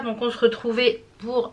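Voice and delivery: usual speaking voice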